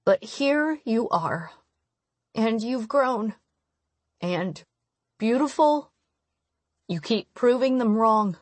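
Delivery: moved mother pausing between phrases; tearful wonder, lightly acted. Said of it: "tears spilling out of her eyes".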